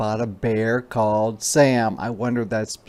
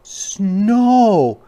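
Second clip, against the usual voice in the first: cub voice, much higher, louder, thrilled; excited little cub; 10 semitones higher, 8 dB louder than usual. Acted. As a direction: shout with joy like a child. Sam excited about snow.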